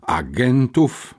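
slow, alarmed question; przestrach. A narrator's reading. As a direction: repeat it slowly with mild alarm. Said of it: "przestraszyła się Magnificencja". (przestraszone, przeciągnięte pytanie)

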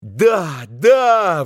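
put-on high, eager boys' cry; rapturous agreement. A narrator's reading. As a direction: echo it with delight, rising in force. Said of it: "восторженно повторили мальчики".

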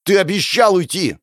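high, shouted reproach; angry reminder. A narrator's reading. Hero reminds the elder of their pact, shouting. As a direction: throw the promise in his face.

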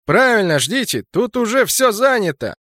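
loud, high-pitched jeer; taunting. A narrator's reading. Enemy jeering at squad standing nearby, taunting. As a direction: call it over mockingly.

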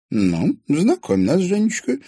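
put-on slow, loud, high old lady's order; commanding old lady. A narrator's reading. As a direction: bid her introduce them. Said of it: "велела".

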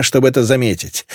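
plain reading voice

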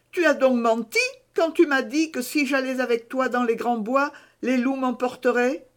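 voix d'enfant plus aiguë, qui fait un reproche; l'enfant prend son père en défaut; petit garçon raisonneur. A narrator's reading. Pierre questionne son père. (petit garçon raisonneur, voix d'enfant aiguë)

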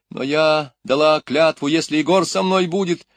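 put-on high, loud child's objection; protesting. A narrator's reading. Люська спорит, отказывается. (громкое возражение высоким голосом)

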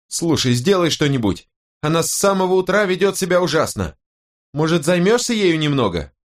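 high-pitched exasperated wife; fed-up wife, voice pitched well up; irritated wife. Acted. Narrator plays irritated wife.

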